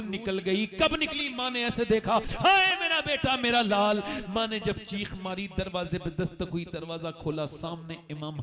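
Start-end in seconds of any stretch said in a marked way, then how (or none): 2.1-4.0 higher, louder keening cry; mother's wail. Acted. Mother grieving over her dead son, a wailing cry.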